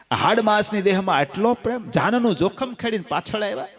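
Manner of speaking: ordinary delivery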